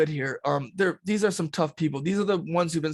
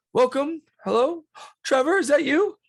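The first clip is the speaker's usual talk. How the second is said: high, loud welcome with a gasp of recognition; high-pitched, thrilled greeting; delighted camp head; 11 semitones higher, 5 dB louder than usual. Acted. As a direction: greet an old friend in a raised, eager voice. Game master voices camp head Stanley.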